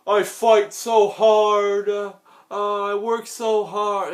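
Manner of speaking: high, slow, whiny mock hero voice; mocking Cena's babyface voice. Ranter mocks John Cena's promo in a high, slow, whiny voice. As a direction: drag each word out like an earnest kid hero. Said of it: "He's like".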